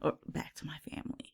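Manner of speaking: soft unvoiced aside; whisper. Real, not acted. Trailing aside to cohost.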